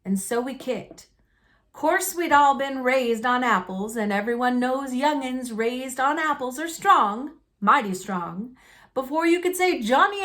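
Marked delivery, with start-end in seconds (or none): none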